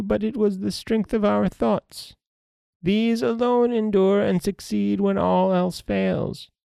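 put-on an eerie fairy crone gently humbling the boy, high and hushed; old fairy woman.

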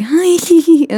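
high-pitched, silly flirty squeal; coy mock giggle. Imitating the exes meeting at a party, coy mock giggle. Imitating how they were at parties.

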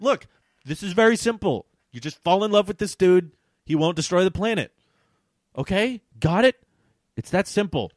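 loud, high, insistent explanation; emphatic explaining. Acted. Acting out impatient explanation to Elaine.

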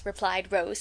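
ordinary delivery, nothing marked

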